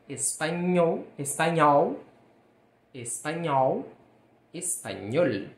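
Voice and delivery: "espanhol" com ñ como NH, "espanhol" bem articulado, devagar. Shown as a demonstration. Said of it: "tem o som do nosso N H". ("espanhol" com ñ nítido)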